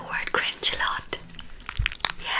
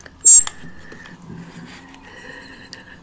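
whispered laugh; 6 dB louder than usual. Real, not acted. Laughing at her own joke.

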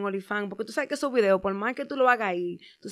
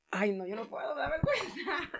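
ordinary talk

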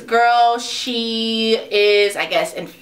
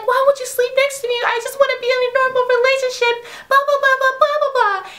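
high, fast whiny rant; mock whiny rant; 14 semitones higher, faster than usual. Vlogger mocking a whiny complaint, high and fast. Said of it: "and he's just like".

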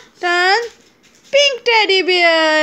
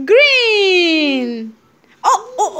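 ordinary talk

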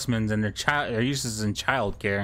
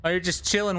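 ordinary talk

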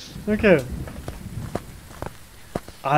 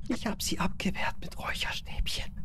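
whisper; 10 dB quieter than usual. Real, not acted. Hiding from ghost, whispering.

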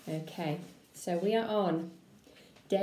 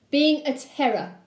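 menacing uncle; 5 semitones higher, 5 dB louder, slower than usual. Acted. Uncle voiced.

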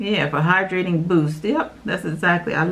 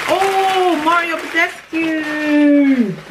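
high, slow, drawn-out cry of the brand name; delighted slow high call; excited announce; 8 semitones higher, much slower than usual. Real, not acted. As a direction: sing out the name like a prize reveal. Excited at new product.

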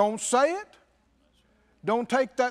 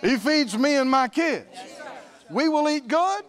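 loud, high preacher's proclamation; full-voiced confession of faith; bold declaration; 5 semitones higher, 5 dB louder than usual. Shown as a demonstration. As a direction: declare it in ringing, separate phrases. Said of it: "Now you start talking like that".

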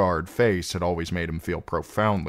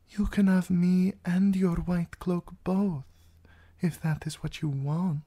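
coaxing princess; 13 semitones higher than usual. Acted. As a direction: tempt the knight with an offer. Princess tempts the knight.

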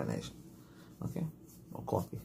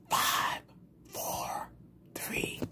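breathy whispered count, barely audible; soft test whisper; whispered numbers; 9 dB quieter, much slower than usual. Shown as a demonstration. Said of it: "Or do you just speak in a normal voice?".

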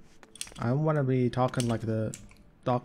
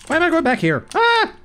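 much higher and louder mock squeal; high silly voice; 10 dB louder than usual. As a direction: squeal it like a cartoon.

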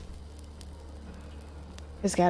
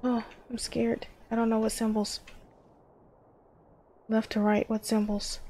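usual speaking voice

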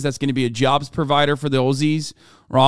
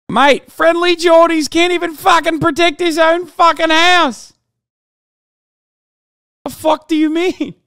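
much higher, louder put-on Australian accent; mock Aussie accent; 13 semitones higher, 5 dB louder than usual. Streamer mocking an Aussie defending Friendly Jordies, high mock Australian accent. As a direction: lampoon an Aussie bloke in a squeaky Australian accent. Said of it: "That's not a fucking accent".

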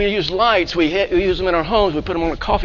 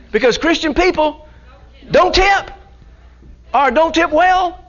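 pitched way up and louder, teasing the congregation; high exclaimed punchline; 10 semitones higher, 3 dB louder than usual. Acted. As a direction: squeal out the joke about Christians not tipping, much higher and louder.

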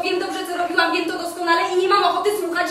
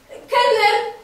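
loud, higher call across the room; 6 semitones higher, 7 dB louder than usual. Acted. Woman calls the waiter across the bar, loud. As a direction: summon the waiter with a raised voice.

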